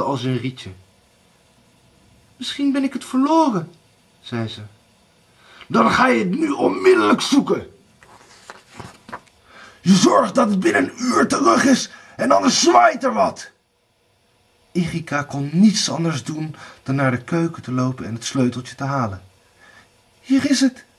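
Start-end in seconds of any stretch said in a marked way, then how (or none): 2.4-3.7 high, quick excuse; a much higher woman's voice, hurried; timid young wife. A narrator's reading. Erika makes excuses to Blauwbaard, nervous and high. 5.7-13.5 raised, high-pitched angry order; angry threat. A narrator's reading. Blauwbaard orders Erika to find the key, angry shout. 20.2-20.8 put-on high young wife; Erika's high voice. A narrator's reading. Erika hands Blauwbaard the key, high-voiced.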